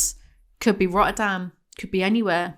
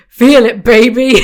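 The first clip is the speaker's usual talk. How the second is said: mock growl; 3 semitones higher, 13 dB louder than usual.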